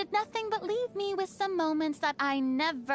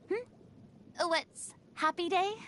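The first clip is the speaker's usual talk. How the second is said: ordinary talk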